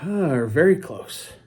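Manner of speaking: slow, sighed emphasis at normal volume; sigh, then stretch the words out; drawn-out emphasis. Real, not acted. Stressing mother-daughter closeness.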